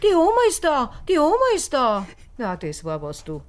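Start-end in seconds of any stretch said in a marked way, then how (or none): 0.0-2.1 put-on high childlike voice for the boy; imitating the boy. Mother retelling what the boy said at dinner, childlike. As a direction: mimic the little boy's cry. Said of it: "der Bub sagt".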